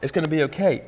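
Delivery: a lightly voiced calm prophet soothing his servant; calm reassurance. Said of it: "Elisha calmly says".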